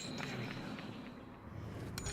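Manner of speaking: whispered relief. Real, not acted.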